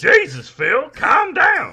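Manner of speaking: high, loud, slow pleading; hammed-up John C. Reilly plea. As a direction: stretch it out in a goofy high voice. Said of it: "Reilly in The Sopranos".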